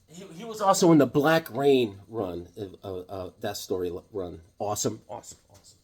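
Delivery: ordinary talk, nothing marked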